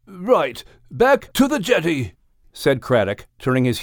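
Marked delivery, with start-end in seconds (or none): 0.1-2.2 pitched-up, brisk-minded character order; resolute whale. Acted. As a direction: take charge as the courteous whale. Craddock answers the alarm decisively.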